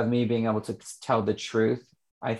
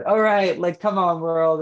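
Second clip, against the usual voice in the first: much higher and louder, rallying cry; pumped call to world; 7 semitones higher, 7 dB louder than usual. Retold or imitated. Ready to face the world after healing, a loud rallying shout. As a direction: throw it out to the whole world, fired up. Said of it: "and I said".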